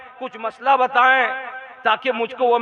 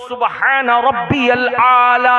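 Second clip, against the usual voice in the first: slow, loud intoned praise; unhurried recitation; 2 semitones higher, 6 dB louder, slower than usual. Shown as a demonstration. Preacher showing worshippers how to pray in prostration, unhurried recitation. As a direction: stretch the final vowels in measured chant. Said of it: "इत्मीनान से बोलो".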